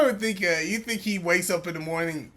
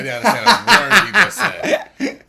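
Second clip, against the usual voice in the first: louder, laughing through the words; real laughing disbelief; laughing outburst; 5 semitones higher, 12 dB louder than usual. Real, not acted. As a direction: burst out laughing as you say it.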